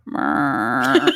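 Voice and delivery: low buzzing mrrr; fax machine sound. Retold or imitated. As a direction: hum like an old fax machine. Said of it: "a little sound like he's a fax machine".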